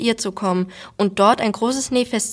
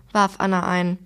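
usual speaking voice

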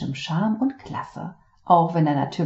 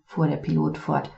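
ordinary delivery, nothing marked

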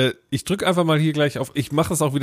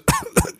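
high staged cough; fake cough. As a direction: cough to fake being ill. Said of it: "da hat man eben so". (gespieltes Husten)

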